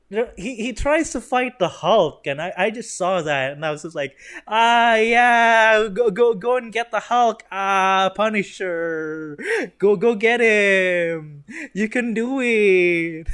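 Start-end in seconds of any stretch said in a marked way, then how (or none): 4.5-13.3 loud, higher, slow hollered encouragement; mock cheering shout. Reviewer egging the Punisher on against the Hulk, mock cheering. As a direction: cheer him on like a fan at ringside.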